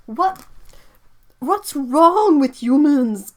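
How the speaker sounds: loud, high burst of real indignation; outraged. Real, not acted. Reacting to dogs dying in fox hunts, outraged. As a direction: cry out in disbelief, stretching the words.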